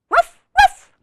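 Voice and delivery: high, loud double woof; puppy woof. Acted. Biscuit answering the reader, high loud puppy woofs. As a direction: yip like an eager little puppy. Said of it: "Biscuit says".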